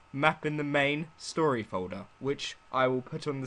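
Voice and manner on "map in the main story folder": ordinary delivery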